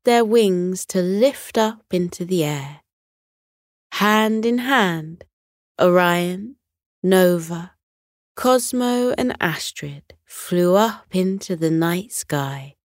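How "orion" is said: ordinary delivery, nothing marked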